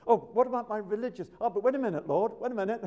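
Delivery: high, loud frantic protest; hammed-up pleading sinner, high and loud; pleading man. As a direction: beg God to wait.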